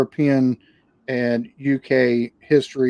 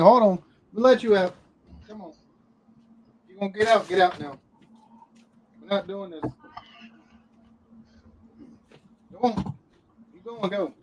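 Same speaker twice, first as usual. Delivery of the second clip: high, soft coaxing voice to a pet; coaxing the dog; 6 semitones higher, 5 dB quieter than usual. Real, not acted. To the dog locked in the bedroom, high coaxing pet talk. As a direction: gently talk the dog out of the room.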